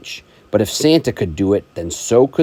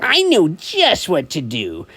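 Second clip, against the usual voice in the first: a higher put-on grumpy Grinch; 8 semitones higher than usual. Parent reading to child, as the grumpy Grinch. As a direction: grumble it as the scheming Grinch.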